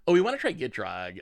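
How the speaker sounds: ordinary talk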